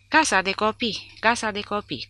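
high, loud chant on one tone; litany-like repetition. A narrator's reading. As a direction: repeat it like a litany. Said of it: "continuă sergentul ca pe o litanie".

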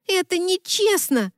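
high, slow whine; moaning complaint. A narrator's reading. Left-out best friend protesting to the bride, moaning. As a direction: wail it as a drawn-out protest. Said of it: "простонала Америка".

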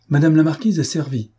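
the butler triumphantly announces dinner to the marquise. A narrator's reading. A proud, ringing announcement. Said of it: "d'un air triomphant".

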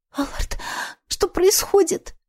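put-on scared little girl asking; frightened. A narrator's reading. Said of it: "Её голос был по-детски испуганным".

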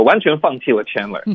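ordinary talk, nothing marked

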